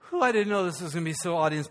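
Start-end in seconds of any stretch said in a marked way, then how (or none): 0.1-0.2 breathy sigh. Real, not acted. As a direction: let the breath out after shouting. Sigh after the big shout.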